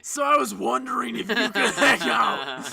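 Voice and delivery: hammed-up loud invitation. Crush finally asking him to hang out, comically loud. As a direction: blurt it out at full volume.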